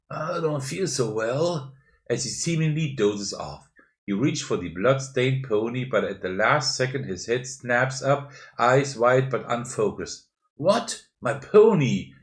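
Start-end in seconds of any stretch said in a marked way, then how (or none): 0.1-1.7 slow, slightly higher drugged voice; drowsy mumble. A narrator's reading. Groggy Charlie, seemingly dozing off after laced tea, drowsy mumble. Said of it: "You hear him mumble". 10.6-12.1 louder, higher outburst; mild bellow of groggy outrage; startled bellow. A narrator's reading. Charlie snapping awake as his pony is taken, startled bellow. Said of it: "he bellows and snatches up".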